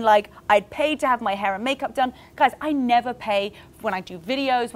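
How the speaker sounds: ordinary talk, nothing marked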